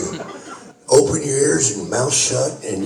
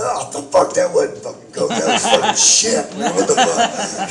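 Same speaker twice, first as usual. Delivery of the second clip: laughing disbelief; 8 semitones higher than usual. Retold or imitated. Retells his own reaction to finding shit.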